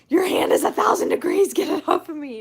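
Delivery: loud, high complaint; exasperated outburst. Retold or imitated. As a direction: snap it out, too hot. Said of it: "and I'm like".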